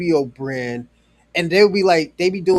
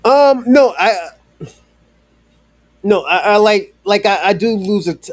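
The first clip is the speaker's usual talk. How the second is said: sudden loud correction; 4 semitones higher, 9 dB louder than usual. Real, not acted. Correcting himself after a chat remark.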